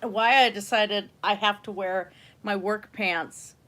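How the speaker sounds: normal talking voice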